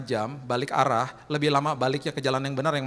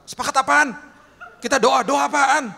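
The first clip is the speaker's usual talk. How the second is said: exasperated retort; 8 semitones higher, 8 dB louder than usual. Retold or imitated. Exasperated reply in retold dialogue.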